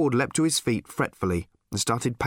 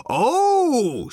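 high, loud sarcastic cry of glee; hammed-up shriek of joy; mock excited shout; 13 semitones higher, 6 dB louder than usual. A narrator's reading. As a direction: yell it like fake delight. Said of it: "shouted Arthur again".

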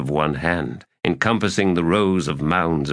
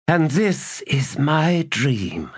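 ordinary delivery, nothing marked

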